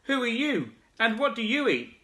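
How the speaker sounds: high calling shout; frog shouting. Acted. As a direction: call up to the upside-down creature. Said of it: "shouted the big wide-mouthed frog".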